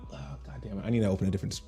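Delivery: ordinary talk, nothing marked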